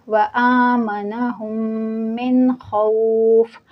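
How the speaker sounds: very slow chant stopping on खौफ with sukun; tajweed recitation. Shown as a demonstration. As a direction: draw out व आमनहुम, end खौफ without -in. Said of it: "मिन खौफिन मैं नहीं पढ़ी हूँ". (आयत के आख़िर में खौफ पर सुकून के साथ रुकना)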